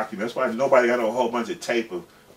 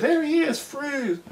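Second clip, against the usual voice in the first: much higher mock police shout; police officer ordering them to freeze; cop yelling freeze; slower than usual. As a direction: bark it like a cop on a raid. Police bursting in.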